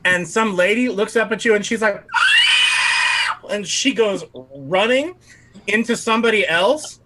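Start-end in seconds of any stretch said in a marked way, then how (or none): 2.1-3.4 a sudden high shriek of fright; high panicked yelp; startled scream. Acted. GM voices startled lady.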